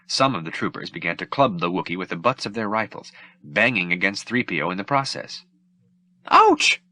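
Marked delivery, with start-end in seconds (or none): 6.3-6.8 very high, loud robotic ouch; droid yelp of pain. Acted. The droid screams as rifle butts bang him, very high. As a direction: yelp like a droid getting hit. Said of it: "the droid screamed".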